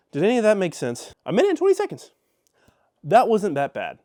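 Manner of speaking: ordinary talk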